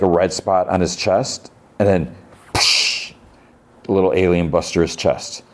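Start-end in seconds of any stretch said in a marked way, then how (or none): 2.5-3.1 voiceless burst noise, like a splatter; imitated burst. Narrator imitates alien bursting out, hissed burst. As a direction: make a short hissing burst sound.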